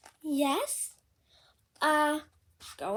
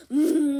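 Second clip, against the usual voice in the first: straining hum; 7 dB louder than usual. Acted. Piggy blowing trumpet.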